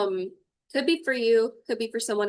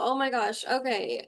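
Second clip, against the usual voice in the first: ordinary talk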